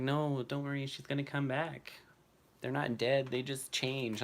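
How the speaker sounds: mock girl voice. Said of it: "She's like".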